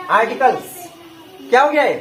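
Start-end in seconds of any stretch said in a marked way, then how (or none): 0.1-0.7 "articles" run together quickly as one word; fast पढ़ना. Shown as a demonstration. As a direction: say "articles" fast, syllables blended. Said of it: "fast पढ़ेंगे". ("Articles" जल्दी से, मिलाकर)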